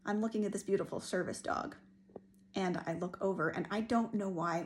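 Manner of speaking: ordinary talk, nothing marked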